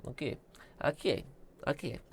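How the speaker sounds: ordinary delivery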